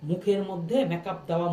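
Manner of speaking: ordinary delivery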